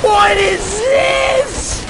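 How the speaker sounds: amazed shout. Real, not acted. Amazed at new game moment.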